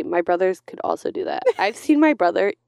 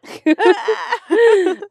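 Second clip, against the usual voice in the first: high laugh; 12 semitones higher, 7 dB louder than usual. Real, not acted. Laughing at own bad accent.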